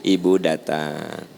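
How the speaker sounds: ordinary talk, nothing marked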